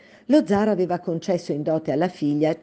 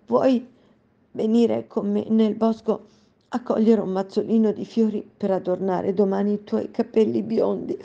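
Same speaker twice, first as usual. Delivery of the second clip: holding back tears; 5 semitones higher than usual. Acted. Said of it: "trattenendo a stento le lacrime".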